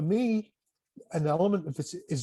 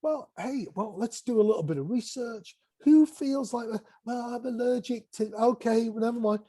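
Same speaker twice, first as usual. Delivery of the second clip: imitating students; 7 semitones higher than usual. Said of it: "People like".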